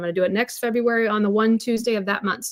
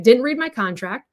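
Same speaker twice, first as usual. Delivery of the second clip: normal talking voice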